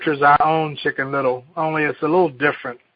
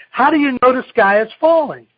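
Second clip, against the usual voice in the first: Goosey Lucy; 8 semitones higher, 5 dB louder, faster than usual. Acted. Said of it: "Goosey Lucy said".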